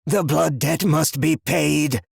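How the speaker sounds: possessed seer shouting; hoarse monotone shout. Acted. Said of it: "he shouted in a hoarse, monotone voice".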